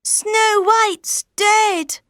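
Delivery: high, slow, mournful little dwarf; slow high-pitched grieving dwarf; sad dwarf. Acted. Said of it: "said the youngest dwarf".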